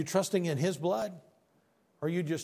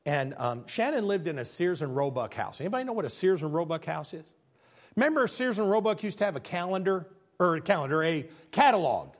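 ordinary talk, nothing marked

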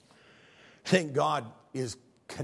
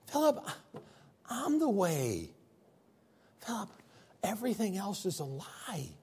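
slow, higher, gentle pleading; tender pleading Jesus; 9 semitones higher than usual. Retold or imitated. Jesus gently pleading with Philip, tender and slow. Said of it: "wounds in his feet going".

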